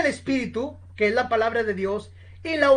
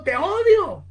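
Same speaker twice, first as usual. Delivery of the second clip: high booming echo; echo roaring back; 6 semitones higher, slower than usual. Retold or imitated. The echo roars back at Francis, booming. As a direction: let it thunder back. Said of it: "repercutieron con gran estruendo".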